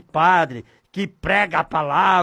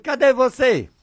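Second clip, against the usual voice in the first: higher-pitched, brief calling question; short raised call; 6 semitones higher, 3 dB louder than usual. Shown as a demonstration. Preacher shows listeners how he asks God, short raised call. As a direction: call out higher, without stretching it. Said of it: "Desse jeito".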